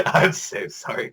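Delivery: slow, laughing, half-whispered apology. Retold or imitated. Character apologizes to Hijikata, slow laughing apology. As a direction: let the laughter break through the apology. Mock apology at episode end.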